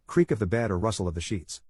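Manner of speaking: usual speaking voice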